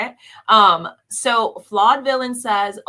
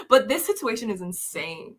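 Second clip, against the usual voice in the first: ordinary talk, nothing marked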